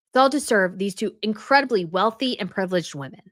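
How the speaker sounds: usual speaking voice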